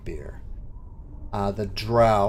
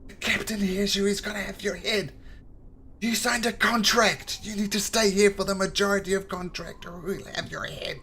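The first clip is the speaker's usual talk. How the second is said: higher, hushed urgent warning; nervous bugbear; 11 semitones higher than usual. Acted. Bugbear warns the drow about the captain, hushed. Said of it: "the bugbear looks around nervously".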